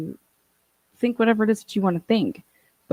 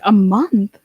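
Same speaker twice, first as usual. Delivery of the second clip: louder, incredulous "A month?"; shocked disbelief; 7 dB louder than usual. Retold or imitated. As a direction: repeat it in loud disbelief. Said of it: "I was like".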